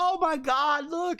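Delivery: mock-excited squeal, very high; high-pitched mock gasp of amazement; excited onlooker. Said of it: "they'll be like".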